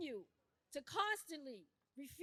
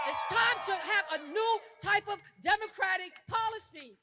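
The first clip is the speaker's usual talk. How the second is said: louder, rising, fast fired-up crowd rally; rousing rally preaching; 3 semitones higher, 8 dB louder than usual. Real, not acted. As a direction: whip up the crowd to act. Rally speech at full force.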